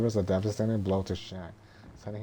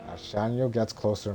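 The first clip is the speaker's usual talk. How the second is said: normal talking voice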